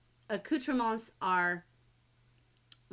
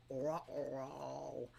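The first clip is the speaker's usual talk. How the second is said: low, soft Ruh-roh; a hushed, comic dog-like uh-oh; cartoonish uh-oh; 7 semitones lower, 10 dB quieter than usual. Teacher voicing everybody's dismay, cartoonish low ruh-roh. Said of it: "everybody's like".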